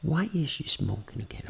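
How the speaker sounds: very soft, private voice; intimate voice. Shown as a demonstration. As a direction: say it hushed, to yourself. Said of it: "Ainda com voz íntima". (voz íntima, bem baixinha)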